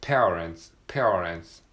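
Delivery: pronunciation drill; R in parents. Shown as a demonstration. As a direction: round the R in parents. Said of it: "ペアのところにRがありますね".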